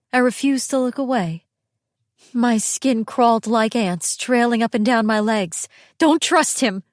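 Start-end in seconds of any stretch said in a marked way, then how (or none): 6.0-6.8 higher, sharper inward outcry; urgent, raised-pitch warning; urgent cry. A narrator's reading. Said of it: "I wanted to cry".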